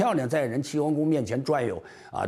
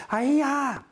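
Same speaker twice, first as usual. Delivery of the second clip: flirty palace lady. Acted. Palace women fawning over handsome man.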